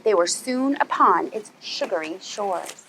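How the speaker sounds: usual speaking voice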